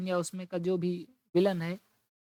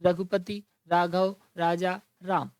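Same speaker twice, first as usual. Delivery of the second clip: the opening रा of Raghupati, Raghav, Raja, राम said louder each time; alliteration stressed; 5 dB louder, slower than usual. Shown as a demonstration. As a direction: punch the रा that starts every word, separated by pauses. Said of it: "तो रा रा से शुरू हो रहा है".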